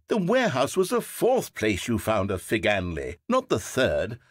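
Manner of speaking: usual speaking voice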